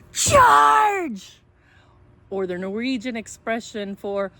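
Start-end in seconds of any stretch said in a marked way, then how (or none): 0.1-1.4 high, loud battle shout; dinosaur yell. A narrator's reading. Dinosaurs charging the house, loud high yell. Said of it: "trio of dinosaurs yelling".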